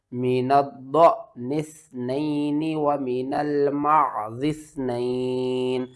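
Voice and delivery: slow, measured tajweed with long held vowels; sustained, level-toned Arabic recitation; Quran recitation. Shown as a demonstration. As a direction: recite it steadily, drawing out each madd. Teacher recites the verse slowly.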